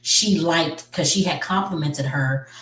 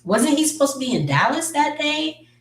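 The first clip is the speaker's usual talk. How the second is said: raised high, pointed doubt; suspicious question; 10 semitones higher than usual. Retold or imitated. Friend noticing the husband's lie, high skeptical question. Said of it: "And her friend was just like".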